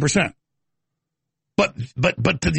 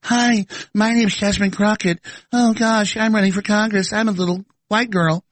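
put-on Jasmine Crockett old voice; 2 semitones higher than usual. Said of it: "Jasmine Crockett in her old voice".

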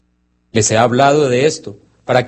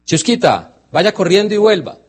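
higher, calling out an order to the dog; owner's firm command; 8 semitones higher than usual. Retold or imitated. A dog owner sends his dog running, firm and higher.

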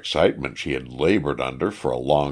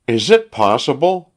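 wife's high, louder outcry of disbelief; crying out; 9 semitones higher, 5 dB louder than usual. A narrator's reading. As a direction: cry it out high, as an indignant question. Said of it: "cried the wife".

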